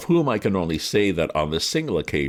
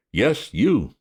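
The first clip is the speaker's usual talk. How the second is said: gruff policeman; 7 semitones higher, 5 dB louder than usual. A narrator's reading. Policeman questions Bertie.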